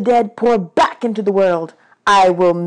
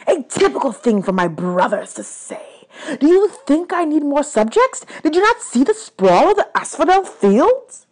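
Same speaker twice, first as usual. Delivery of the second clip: raised, louder ranting god; indignant god of the dead; 8 semitones higher, 5 dB louder than usual. Acted. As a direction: rant like an affronted underworld lord.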